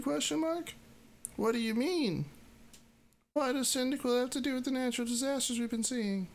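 high-pitched Mudkip pleading. Acted. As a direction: pipe up like a curious little Pokemon. Mudkip asking Xatu.

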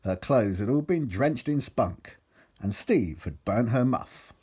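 ordinary delivery, nothing marked

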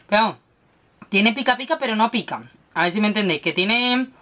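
talking around a candy; hard candy in mouth. Real, not acted. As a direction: cheek stuffed with sweet. Seen 3: Cheek bulges, finger points at cheek; she speaks around candy.

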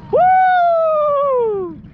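high happy cheer; excited whoop. Real, not acted. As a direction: whoop with joy. Finally moving the boat.

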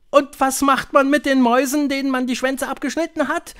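high, loud questioning voice; high-pitched, loud crowd question; eager witch audience. A narrator's reading.

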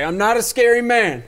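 high, loud mock announcement; mock shout. Man exploding from an alley at passers-by, mock shout. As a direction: blurt it out like a man bursting from an alley.

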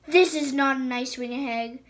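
loud hissing snarl; venomous loud hiss. Acted. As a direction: hiss it at him, furious. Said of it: "she hissed".

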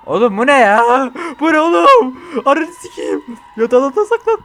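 screaming in panic to hide; panicked yelling. Real, not acted. Frightened player yells at the monster in panic.

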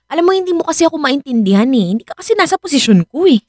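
rushed, fast, pleading complaint; fast frustrated pleading. Acted. Robin complaining to Rika that she is not understood, fast and frustrated. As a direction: rush the words out in frustration.

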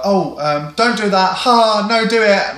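high, loud mock passive-aggressive fussing; hammed-up passive-aggressive protest. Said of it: "a bit passive aggressive".

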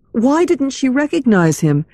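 ordinary delivery

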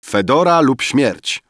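high, loud proclamation; declaimed. A narrator's reading. Poet answering Rafael that he hears, loud declamation. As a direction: declaim it like a battle cry. Waking poet echoes the story.

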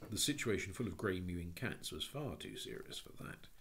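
ordinary delivery

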